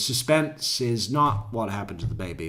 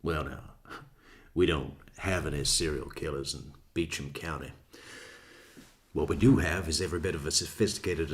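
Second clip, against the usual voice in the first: Southern judge voice; 7 semitones lower, 6 dB quieter than usual. Retold or imitated. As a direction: deliver it like a stern Southern judge. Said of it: "What does he say?".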